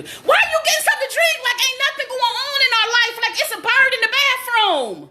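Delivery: high, rapid panicked rant; exasperated scolding. Real, not acted. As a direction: rattle it off high, outraged at their calm. Scolding companion during bird emergency.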